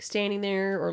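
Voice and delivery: ordinary delivery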